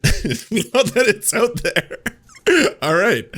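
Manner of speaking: slow, high, loud words through laughter; real laughing. Real, not acted. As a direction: crack up while getting the words out.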